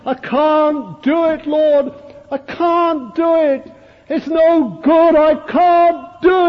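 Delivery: high, loud, slow sobbing cry of despair; sobbing plea. Retold or imitated. Preacher voicing a weeping woman before the Lord, high anguished sobbing. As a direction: voice a broken woman sobbing to God. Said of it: "sobbing her heart out".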